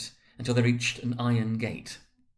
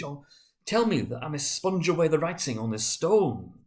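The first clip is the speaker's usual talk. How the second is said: higher, louder impassioned promise; desperate vow; 7 semitones higher, 4 dB louder than usual. Acted. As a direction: swear it with all your heart.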